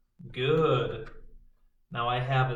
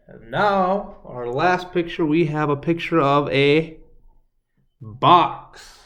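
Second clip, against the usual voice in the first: louder, rising to a big "box"; excited build-up; 10 dB louder than usual. Real, not acted. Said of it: "Get your arms out again".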